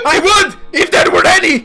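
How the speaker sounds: bickering orc. Acted. Orc answering the elf's teasing, riled shout. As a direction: yell it back like a riled orc.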